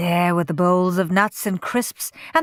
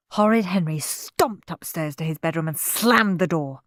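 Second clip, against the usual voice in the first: plain reading voice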